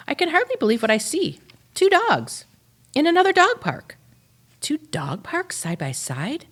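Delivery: high, loud and fast with amazement; amazed. Acted. Dog spotting another dog park, high, loud and thrilled. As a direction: burst out in disbelief.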